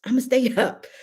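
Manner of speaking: lively friend asking if she's streaming tonight; Miss Brenda. Retold or imitated. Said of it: "Miss Brenda calls me ... and say".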